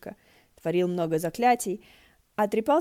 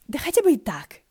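pitched up and louder, cheerfully defiant; playful comeback, raised voice; high defiant retort; 8 semitones higher, 8 dB louder than usual. Acted. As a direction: toss it back brightly. Shi Qingxuan retorting, high.

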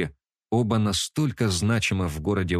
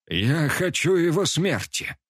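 menacing; 8 semitones higher than usual. A narrator's reading. Old orc demands death.

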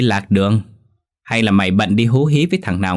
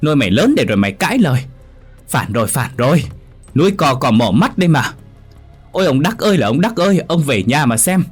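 much higher, louder and fast hysterical screeching; wailing high-pitched shouts to the absent husband, very fast; shrieking tirade; 10 semitones higher, 4 dB louder, faster than usual. Acted. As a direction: scream the house down like an outraged stepmother. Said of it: "hét um trời".

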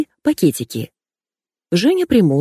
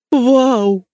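high, ecstatic whoop; delighted squeal; 7 semitones higher, 3 dB louder than usual. A narrator's reading. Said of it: "Стилист взвизгнул". (восторженный высокий визг)

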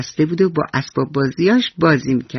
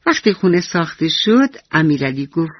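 plain reading voice

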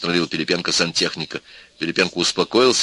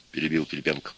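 ordinary delivery

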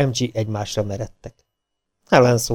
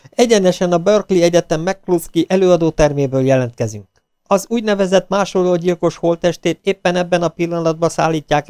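higher-pitched on-the-scene news report; live TV reporter; 6 semitones higher than usual. Acted. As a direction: announce it live to the viewers.

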